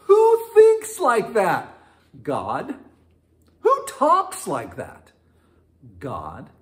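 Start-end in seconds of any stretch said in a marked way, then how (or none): 0.1-1.8 high, loud, slow marvelling question; amazed exclaim. Acted. Preacher marvelling at how God thinks, high and slow. 3.6-5.1 high, loud, slow marvelling question; amazed exclaim. Acted. Preacher marvelling at how God thinks, high and slow.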